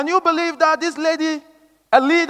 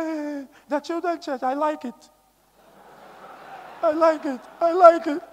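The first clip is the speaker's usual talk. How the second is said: mock tearful whine, higher pitched; imitated tearful plea; 4 semitones higher, 4 dB quieter than usual. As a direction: imitate a sister pleading through tears. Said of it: "Why are you crying?".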